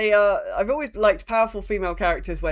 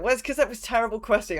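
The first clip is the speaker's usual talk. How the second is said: ordinary delivery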